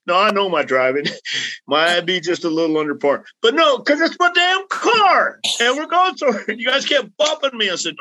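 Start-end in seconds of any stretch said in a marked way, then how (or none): 3.4-7.7 indignant. Real, not acted. Driver complaining friends kept bumping his car, indignant. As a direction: protest hard, it's his own damn car. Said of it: "indignant about his car".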